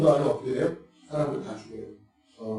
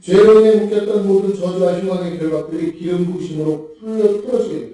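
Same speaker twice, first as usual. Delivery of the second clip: raised, louder pulpit proclamation; emphatic preaching; 6 semitones higher, 6 dB louder than usual. Real, not acted. Preacher proclaiming to congregation that anointing breaks every chain, emphatic preaching. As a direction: declare it forcefully, pitched up. Preaches promise of broken yokes.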